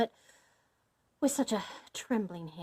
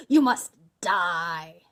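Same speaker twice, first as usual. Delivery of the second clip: loud, very slow villain; heavy, deliberate threat; menacing death sentence; 3 semitones lower, 9 dB louder, much slower than usual. A narrator's reading. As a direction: drag out each word with finality. Bluebeard condemns her again.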